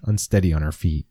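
ordinary delivery

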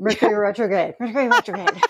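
"Mercury retrograde" rattled off quickly, twice; rapid-fire repeats of "Mercury retrograde"; fast tongue-twister. Shown as a demonstration. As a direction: race through "Mercury retrograde" until the sounds blur. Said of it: "Say it five times fast".

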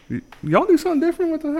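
high, quick country twang; mock country accent. Visiting relatives up north, high country twang. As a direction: exaggerate the country talk, stumbling at the start. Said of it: "Oh, you're so country".

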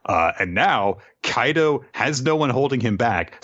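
ordinary delivery

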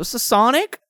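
high, questioning character voice; Tails. Acted. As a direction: lift the pitch for a curious character voice. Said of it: "Said Tails".

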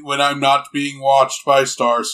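even machine voice on one pitch; Soundwave. Acted. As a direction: imitate a droning robot, played for laughs. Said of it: "talks like this".

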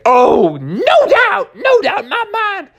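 very high, eager cub voice; very high excited cub. Acted. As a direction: jump at the chance. Junior eager to drive.

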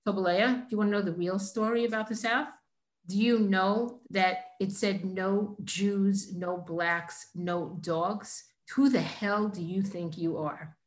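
normal talking voice

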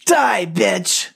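a mild snarl in another voice; understated snarling attacker. A narrator's reading. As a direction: spit out the last threat.

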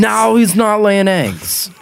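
put-on Southern accent. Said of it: "I'm using a Southern accent for Jersey".

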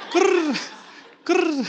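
high pigeon kurr; pigeon coo. Shown as a demonstration. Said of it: "they're cooing".

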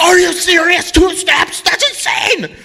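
imitating her disbelief, very high-pitched; hammed-up high squeal of horror; mock shocked shriek. Retelling her horrified shocked reaction.

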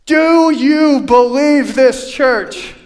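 loud, slow call to the congregation; calling out to church. Acted. Pastor loudly asking his church whether they believe. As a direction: shout the question out to the pews.

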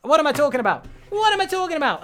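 real high, loud self-correction; flustered realisation. Real, not acted. Podcast host remembering the anagrams segment comes first, flustered outburst. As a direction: blurt it out as you catch your own mistake.

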